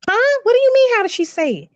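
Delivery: put-on high-pitched puzzled child's reply; high, taken-aback "what do you mean?"; baffled child. Daughter answering her mother's demand to act it out, baffled and high. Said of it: "I had to do the accent".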